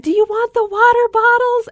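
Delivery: high, loud helpful call; eager principal. Retold or imitated. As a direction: call after them brightly. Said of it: "he's like".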